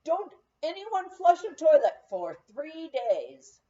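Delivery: disguised plumber announcing loudly to the guests; loud announcement. Retold or imitated. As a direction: declare it to the whole room. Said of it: "and then announced".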